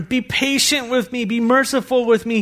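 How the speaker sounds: begging servant. A narrator's reading. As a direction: beg the master for time. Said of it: "he begged".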